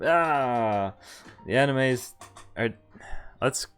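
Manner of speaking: normal talking voice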